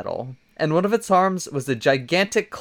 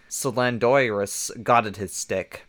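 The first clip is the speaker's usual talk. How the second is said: usual speaking voice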